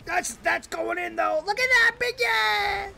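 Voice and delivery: high excited yell, rising to a shout; excited cheering. Real, not acted. Gamer cheering a shot going in, excited. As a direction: cheer the ball in at full volume.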